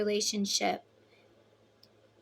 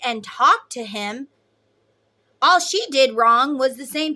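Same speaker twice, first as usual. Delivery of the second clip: louder and higher, a heated tirade slowing at the end; retold angry rant; 4 semitones higher, 7 dB louder than usual. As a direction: vent at him, furious but controlled.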